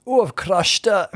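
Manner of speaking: high, slow, genial Scottish lady; Scottish accent. Retold or imitated. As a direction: quote her in a sly Scottish voice. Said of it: "genial Scottish lady of a certain age".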